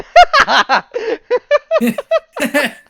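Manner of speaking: very high, loud laughing fit; helpless laughter. Real, not acted. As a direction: laugh until the words are gone.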